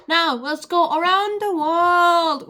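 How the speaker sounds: loud, high, drawn-out showy call; big segment announcement. Real, not acted. Presenter launching the round-the-world segment, big announcement. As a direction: belt it out slowly, stretching the words high.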